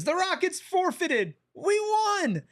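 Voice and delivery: high, drawn-out hammed-up cheer; mock celebration. Host reads a viewer's joke about Rockets forfeiting, high mock-excited. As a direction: announce a fake win in mock triumph.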